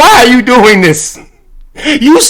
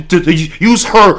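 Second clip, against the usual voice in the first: usual speaking voice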